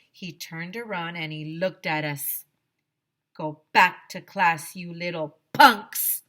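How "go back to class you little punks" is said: loud, slow barked command; barked order. Acted. Mean principal barking at the children, loud and slow. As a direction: bark it at the kids. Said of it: "he barked".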